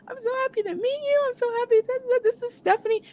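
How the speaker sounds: very high gushing star voice; gushing excitement. Retold or imitated. Star introducing the fan she is meeting, gushing.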